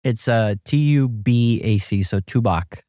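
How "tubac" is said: "Tubac" said as TOO-bock; pronunciation of Tubac. Shown as a demonstration. Golfer showing the host how to say the town, "Tubac" with a b. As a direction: say "Tubac" with a b in the middle, falling at the end. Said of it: "How do you say it?".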